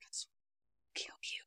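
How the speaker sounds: harsh unvoiced whisper, barely audible; whispered threat. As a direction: hiss the threat under your breath at a funeral. Friend threatens at funeral.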